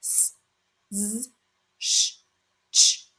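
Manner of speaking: s, z, sh, ch each held alone as a hiss; hissing sounds. Shown as a demonstration. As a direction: sound out "s, z, sh, ch" one by one with pauses. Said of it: "one of these hissing sounds".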